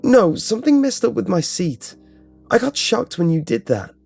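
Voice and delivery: high-pitched upset protest; acted alarm after a shock; alarmed reply. A narrator's reading. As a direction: complain urgently, voice pitched right up. Answers after being shocked, upset.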